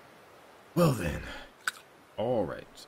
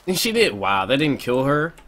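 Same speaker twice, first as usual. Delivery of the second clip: loud disbelief; 8 semitones higher, 11 dB louder than usual. Real, not acted. Trap failed to kill her.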